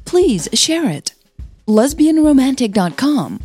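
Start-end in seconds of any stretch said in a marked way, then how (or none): none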